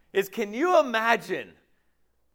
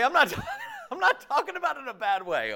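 ordinary talk

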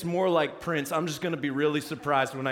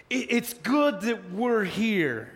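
dazed Peter; 6 semitones higher, slower than usual. Retold or imitated. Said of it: "Peter's like".

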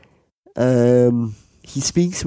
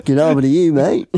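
broad mock Australian, rising; Australian accent; 2 semitones higher, 4 dB louder than usual. Tall man matily praises fellow passenger, broad Australian accent. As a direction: lay the Australian on thick. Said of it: "it's gone so Australian".

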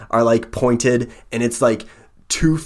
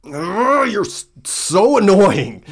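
high, loud, whiny groan; a fed-up creature gives up; the centipede is fed up with the stubborn girl; exasperated monster; 10 semitones higher, 4 dB louder, slower than usual. Acted.

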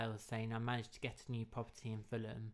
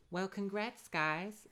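Gretchen's voice; 8 semitones higher, slower than usual. Acted. Gretchen voiced in read story.

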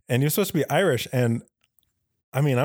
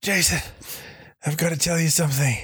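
high, halting mock deathbed voice; hammed-up last words to a comrade; dying soldier; 6 semitones higher than usual. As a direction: gasp it out like a dying brother in a movie. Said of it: "as he died in his dying wish".

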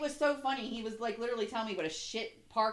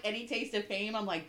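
usual speaking voice

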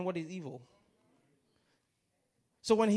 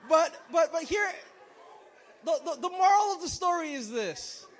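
loud call; 8 semitones higher, 6 dB louder than usual. Real, not acted. Pulls crowd back to the moral.

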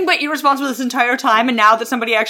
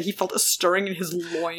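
hushed mock sultry read; 8 semitones lower, 11 dB quieter than usual. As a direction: breathe it like cheesy romance prose. Reading racy line.

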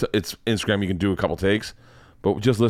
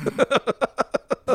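high-pitched laugh; a short, high burst of laughter. Real, not acted. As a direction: laugh at your own confession. Amused at own bad-gig confession.

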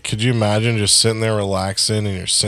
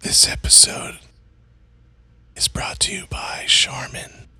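breath-only whispered sponsor plug; whispered ad read. Shown as a demonstration. Co-host mocking podcast ads, whispered. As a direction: whisper it like an ASMR sponsor spot. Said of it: "you're whispering ad spots".

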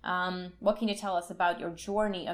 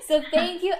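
laughing; 8 semitones higher, 5 dB louder than usual. Real, not acted. Host thanks guest, laughing.

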